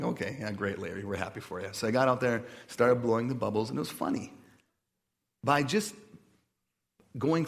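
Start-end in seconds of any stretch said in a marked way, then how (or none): 0.0-1.7 low, dry, unimpressed mock approval; sarcastic. Pastor retelling his reply to the director, sarcastically low-key. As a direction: humour him with deadpan congratulations. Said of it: "I started in a very sarcastic place".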